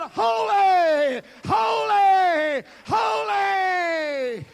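high, loud, drawn-out holy; exalted cry. Acted. Seraphim crying out before the throne, high and loud. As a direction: cry each holy out like an angel.

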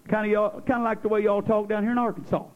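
ordinary talk, nothing marked

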